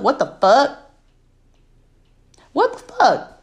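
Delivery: high, incredulous what the fuck; exasperated disbelief. Real, not acted. Vlogger fumes at a guest who never listened, incredulous. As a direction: let the disbelief rise each time.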